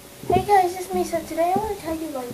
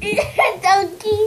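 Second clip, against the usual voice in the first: laughing while quoting; 6 semitones higher, 3 dB louder than usual. Retold or imitated. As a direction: quote it through laughter. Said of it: "the girl was saying".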